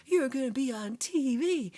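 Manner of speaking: high, loud mock gushing; hammed-up hype. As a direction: hype up your friend with over-the-top excitement. Acting out gushing texts.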